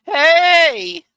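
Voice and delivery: high, loud drawn-out game character shout; in-character yell. Acted. Streamer imitating the game's characters, random yelling in character. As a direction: yell one long, high syllable in character.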